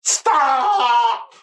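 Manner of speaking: high, loud desperate shout; necromancer's shout. Acted. Necromancer rushing at the ogre, high panicked shout. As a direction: yell it to halt the ogre. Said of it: "Ned the Necromancer then rushes towards the ogre and goes".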